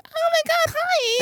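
put-on very high, thin girlish squeal. Mimicking Jenny's girly scream greeting her dad, very high squeal. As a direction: squeal hello like an excited girl.

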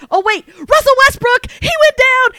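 high, excited fan squeal; gleeful realization. Retold or imitated. Fan realizing the rival team is out, gleeful squeal. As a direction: crow over the beaten rival in a very high voice. Reliving dawning glee at rival's exit.

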